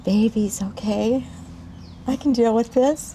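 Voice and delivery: slow, rising attempt at acceptance; self-reassurance. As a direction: talk yourself round to it, slowing down. Recalled thought on hearing news.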